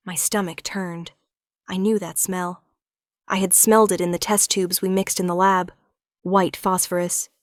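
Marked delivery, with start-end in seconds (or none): none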